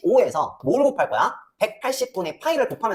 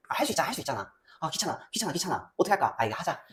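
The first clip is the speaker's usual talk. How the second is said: much softer, lower aside to oneself; muttered grumbling; 4 semitones lower, 10 dB quieter, faster than usual. Real, not acted. Teacher to self over a tedious sum, muttered grumbling. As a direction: grumble it quietly under your breath.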